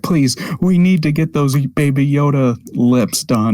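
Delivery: hammed-up pleading; pleading animation lead. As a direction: beg like an overworked team lead. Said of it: "Meanwhile, some poor, uh, animation lead".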